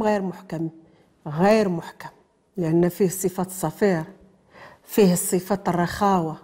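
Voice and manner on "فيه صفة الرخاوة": usual speaking voice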